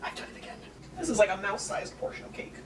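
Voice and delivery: hushed whisper. Real, not acted. Whispering about the tiny cake to the camera.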